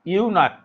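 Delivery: ইউনাক with the final CH as a hard k. Shown as a demonstration. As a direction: say ইউনাক loudly, ending on a k sound. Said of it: "সিএইচ এর উচ্চারণটা কয়ের মতো".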